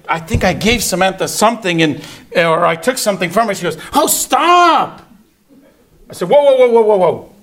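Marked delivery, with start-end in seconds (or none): 3.9-5.1 loud high bratty outburst, drawn out; exaggerated sassy "stop"; little attitude. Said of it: "The little attitude". 6.3-7.3 loud, high rapid-fire run of whoas; retold parent cutting the kid off; mock-alarmed stop signal; startled halt. Father halting daughter's backtalk.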